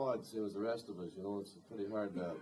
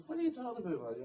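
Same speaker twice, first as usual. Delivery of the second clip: indignant surprise; 11 semitones higher, faster than usual. Retold or imitated. Singer retorting to the critic who mocked the song, with high incredulous surprise. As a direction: pitch jumps high on an incredulous question.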